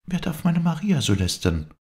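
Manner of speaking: very high, outraged challenge; indignant cry. A narrator's reading. Viola defends Maria from slander, crying out indignantly. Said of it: "rief Viola".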